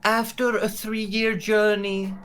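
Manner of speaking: stately official statement with measured pauses; regal orator. Acted. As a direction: address the crowd formally, reassuring them. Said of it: "And she says".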